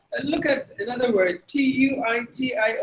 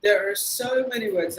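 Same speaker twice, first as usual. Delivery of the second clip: ordinary talk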